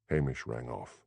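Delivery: ordinary delivery, nothing marked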